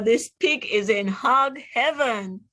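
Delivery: usual speaking voice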